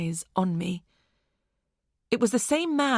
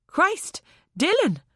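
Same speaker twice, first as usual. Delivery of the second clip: sudden high startled cry of a name; 10 semitones higher, 3 dB louder than usual. Acted. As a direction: gasp his name out in shock. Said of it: "Zoe cried".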